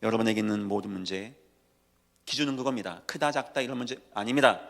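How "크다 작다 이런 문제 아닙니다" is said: ordinary delivery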